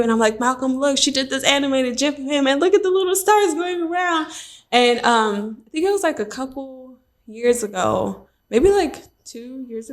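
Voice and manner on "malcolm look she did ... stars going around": gushing, pitch climbing as it goes; delighted fangirl pointing out details; retold excitement. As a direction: show it off eagerly, voice rising higher. Retold excited showing to husband.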